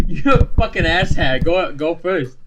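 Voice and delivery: high, loud mock insult; aggressively nice. Ordering the friend to go first, aggressively nice. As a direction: fire back in playful outrage. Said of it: "most aggressive, like, being nice".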